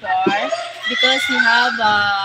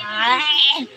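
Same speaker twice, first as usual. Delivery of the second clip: embarrassed laugh; 2 semitones higher, 4 dB louder than usual. Real, not acted. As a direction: burst into a flustered giggle. Flustered while explaining in English.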